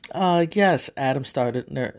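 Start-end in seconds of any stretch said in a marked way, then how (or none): 0.1-0.9 hesitant, higher-pitched start; nervous. Acted. Adam working up to ask God a favour, mildly nervous. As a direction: begin timidly, with a touch of nerves. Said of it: "started nervously".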